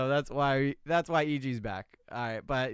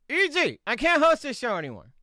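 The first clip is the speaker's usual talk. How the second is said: breaking character; 8 semitones higher, 5 dB louder than usual. Real, not acted.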